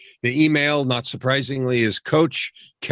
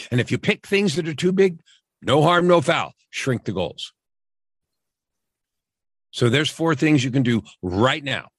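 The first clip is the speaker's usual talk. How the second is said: ordinary talk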